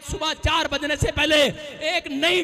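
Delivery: loud, rising prophetic declaration; preaching at full force. Acted. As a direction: build the prophecy to a shout. (पूरे ज़ोर से प्रचार करते हुए)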